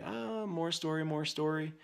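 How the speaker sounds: high singsong imitation of excuses; mock whining kid. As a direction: parody a child droning on. Parent mimics son's stories.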